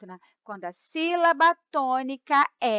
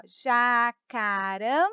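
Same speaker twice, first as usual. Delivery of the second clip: jacarandá drawn out slowly, landing on final DÁ; slow syllable-by-syllable jacarandá, pause before a stressed dá; much slower than usual. Shown as a demonstration. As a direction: stretch out jacarandá, then stress the last syllable, dá. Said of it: "quando a última sílaba é tônica".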